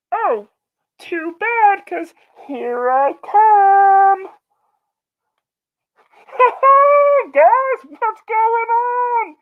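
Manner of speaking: a manic little guy who's thrilled to be on camera; giddy intruder character. Acted. Intruder greeting the chat and fighting off Matt, gleeful.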